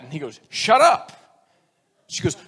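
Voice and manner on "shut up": loud, high parroted retort; cheeky boy. Acted. Little boy answers his teacher, cheeky. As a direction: blurt it out brightly and loud.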